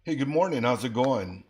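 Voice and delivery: bright, loud hello; cheerful greeting. Real, not acted. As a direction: greet them loudly and warmly. Greeting caller on stage loudly.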